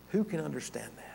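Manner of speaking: soft unvoiced whisper; a whispered question of wonder. Real, not acted. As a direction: drop to a hushed whisper of awe. Preacher whispers rhetorical question.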